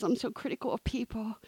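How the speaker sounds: hushed, ashamed whisper; whispered confession. Retold or imitated. Confessing her critical thoughts to Ed in a whisper. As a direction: admit it under your breath.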